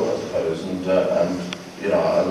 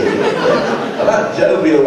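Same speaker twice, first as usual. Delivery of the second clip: mock jovial; 8 semitones higher, 5 dB louder, much slower than usual. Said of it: "public speaking school".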